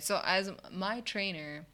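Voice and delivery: usual speaking voice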